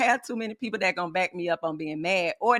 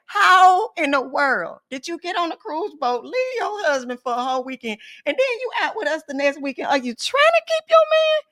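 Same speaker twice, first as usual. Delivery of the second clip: higher, louder incredulous lecture; retold scolding; 8 semitones higher, 3 dB louder than usual. Mentor berates woman who left her husband, incredulous. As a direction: build to a disbelieving scold.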